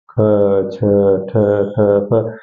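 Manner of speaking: ख, छ, ठ, फ each said alone with a puff of air; aspirated sounds. Shown as a demonstration. Teacher showing learners that extra air comes out, ख, छ, ठ, फ said aspirated. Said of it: "अधिक मात्रा में वायु इनसे बोल".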